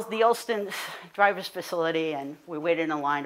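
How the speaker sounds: ordinary delivery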